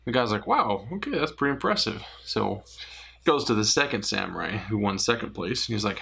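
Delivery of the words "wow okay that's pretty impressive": higher, amazed reaction from the joke's guy; impressed guy. Acted. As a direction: gasp at the sword trick, pitched up. Joke, voicing the impressed guy.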